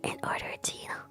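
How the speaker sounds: soft ASMR whisper close to the mic. Real, not acted. Streamer trying out ASMR for viewers, whispering. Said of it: "if I'm whispering really close to the mic".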